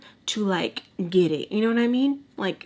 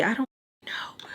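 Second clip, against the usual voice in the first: whisper; 3 semitones higher, 4 dB quieter than usual. Real, not acted. Sheepish aside about own mistake.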